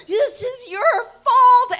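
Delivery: high, loud, drawn-out mock accusation; slow, overdone cry of reproach; accusing. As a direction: wail the blame like a dying victim. Dying dove blames the teller.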